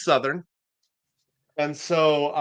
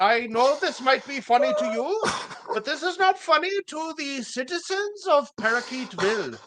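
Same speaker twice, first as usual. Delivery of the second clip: put-on comic foreign accent, pitched well above usual; [name]'s foreign accent; 8 semitones higher than usual. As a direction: plead the town's case in a hammed-up foreign accent. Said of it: "I have to speak in a foreign accent".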